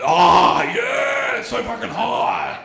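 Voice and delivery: breathy, moaning "oh yeah"; horny guy moaning. Retold or imitated. Guy on the chat, hammed-up aroused moan. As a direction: ham up a turned-on guy. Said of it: "this guy's just fucking".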